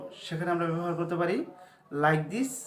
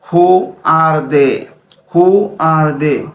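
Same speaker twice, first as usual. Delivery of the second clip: slow drill; 17 dB louder, much slower than usual. Shown as a demonstration. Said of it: "teacher drills English sentence".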